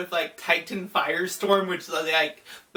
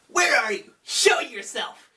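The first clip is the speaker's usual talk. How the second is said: higher, mock-dramatic challenge; Kaiba; 6 semitones higher than usual. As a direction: call out a theatrical challenge, drawing out the end. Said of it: "Kaiba's like".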